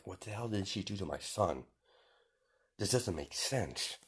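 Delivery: hushed, puzzled thinking aloud; inner thought. Acted. Mother wondering what was done to her son, hushed. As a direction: murmur it softly, as if to yourself. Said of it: "she's thinking".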